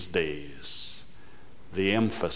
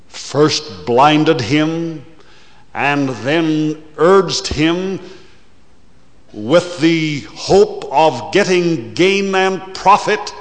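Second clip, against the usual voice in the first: preaching at full force; 8 semitones higher, 11 dB louder than usual. Acted. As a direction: thunder it from the pulpit. Preacher builds to a climax.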